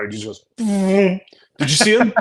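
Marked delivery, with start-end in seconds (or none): 0.6-1.2 loud, high mouth-made whoosh; quick vocal zoom sound; imitated fly-by noise. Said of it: "he makes the noise". 1.6-2.1 loud, high mock amazement; high-pitched, hammed-up thrill; excited prankster. Acting out the prankster's excited showing.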